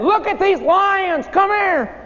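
put-on high, eager children's call to the teacher; excited kids calling out. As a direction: call him over like a thrilled schoolkid. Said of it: "We said".

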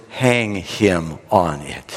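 slow, low pronouncement; acted grim royal verdict. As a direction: hand down the sentence word by word. King pronounces the death sentence.

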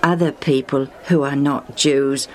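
low, self-pitying voice; dejected. Acted. Blind Lucius doubting he'd be touched, dejectedly. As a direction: sink in pitch, slowing at the end.

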